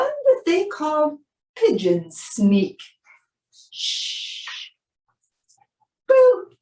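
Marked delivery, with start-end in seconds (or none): 3.7-4.4 breathy hush, no voice; whispered shush. Acted. Sneaking up on pigeons, a whispered shush. 6.1-6.5 sudden high shouted boo; loud boo. Acted. Springing out at the pigeons, a loud high boo.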